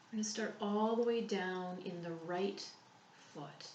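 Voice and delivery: calm, unhurried meditation voice with long pauses; soothing body-scan guide, softening as it goes; guided relaxation. Real, not acted.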